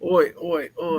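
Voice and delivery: cartoon Australian chant, up high; Australian accent. Australian accent, hammed up as a bad joke on the podcast. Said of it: "That was terrible. Never do that accent again".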